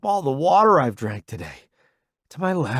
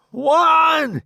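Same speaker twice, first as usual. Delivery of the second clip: high, loud call; shout; 8 dB louder than usual. Acted. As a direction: yell the count out. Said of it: "he shouts".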